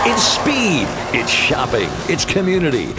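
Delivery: punchy, rising promo voice; hype ad read. Acted. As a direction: sell the race night with energy. Reads a hype race ad.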